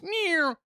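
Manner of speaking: high-pitched sound effect for a twisting leg; imitated leg noise. Storyteller voicing his leg giving way, high imitated noise. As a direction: make a quick, high cartoon noise.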